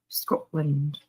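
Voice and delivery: "Scotland" with a rolled Scottish r and a clipped end; Scottish accent. Shown as a demonstration. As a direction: say "Scotland" the way a Scot would. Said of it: "with a good Scottish accent is".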